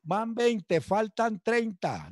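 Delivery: high, very slow counting of the minutes; slow drawn-out count. Retold or imitated. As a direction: stretch each number like a tormenting sergeant. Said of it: "empezaban a contar".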